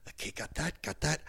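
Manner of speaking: muttered checklist. Shown as a demonstration. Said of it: "That's what I do a lot".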